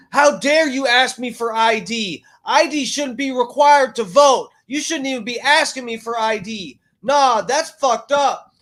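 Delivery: high, loud mock indignation; mock outraged shriek. Mocking the woman yelling at poll workers, high and loud. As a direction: ham up her outrage at being asked for ID. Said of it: "She's like".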